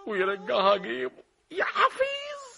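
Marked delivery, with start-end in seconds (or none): 1.5-2.4 shrill shriek. Acted. Coin shrieks in disgust.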